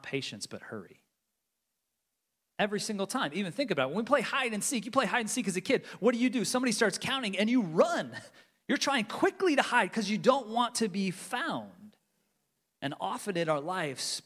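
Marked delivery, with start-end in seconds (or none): 2.6-12.0 raised, higher, insistent sermon push; emphatic preaching. Acted. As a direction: drive the point home with urgency. Preacher presses hide-and-seek example.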